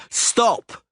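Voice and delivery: sharp high yelled stop. Acted. Cicada blocking the witcher's way, high yell. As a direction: bark him to a halt. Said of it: "Cicada yelled".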